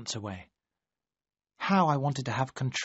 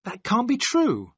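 cried out; 8 semitones higher, slower than usual. Acted. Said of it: "I cried".

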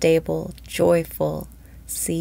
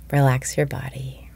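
guided meditation. Acted. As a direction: ease listeners into calm, breath by breath. Leads a daily guided meditation.

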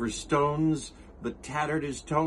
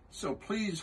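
earnest, higher elephant voice; Horton the elephant; 7 semitones higher, 5 dB quieter than usual. Acted. Horton pleading with the kangaroo, earnest higher voice. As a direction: plead gently as the kind elephant.

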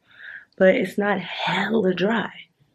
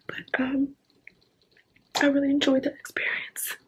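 mock emotional whisper; 7 semitones higher, 5 dB quieter than usual. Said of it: "it makes me emotional when I talk about skincare".

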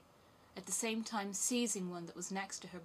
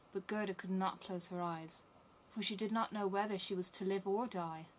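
ordinary delivery

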